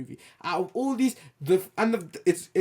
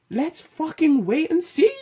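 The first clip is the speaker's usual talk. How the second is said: high, drawn-out mock studio exec; 13 semitones higher, 3 dB louder than usual. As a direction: play the shrugging studio boss, slow and high. Studio imagined saying go.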